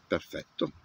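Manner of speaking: usual speaking voice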